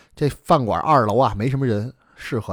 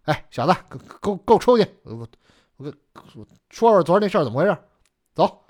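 pretend scolding, raised voice; 5 semitones higher than usual. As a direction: put on a stern show for someone listening. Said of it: "假装还高声说".

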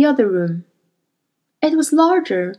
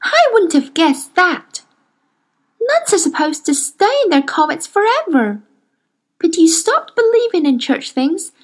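put-on higher girl's voice, wondering; a child puzzling at a strange world; lightly voiced young girl, amazed; Lyra marvelling; 5 semitones higher than usual.

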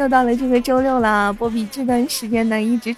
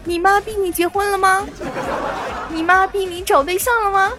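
nagging meme quote; 7 semitones higher than usual. Retold or imitated. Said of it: "那句来自于我们网上的那句".

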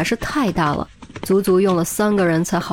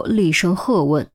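usual speaking voice

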